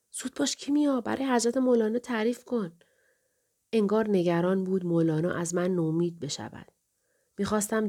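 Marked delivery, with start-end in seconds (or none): 0.1-2.7 father's higher-pitched scolding, lightly acted; mild scolding. A narrator's reading. Father urges Kimia to describe for Molana, mildly scolding. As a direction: chide the child to hurry, without shouting. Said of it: "پدرم تشر زد".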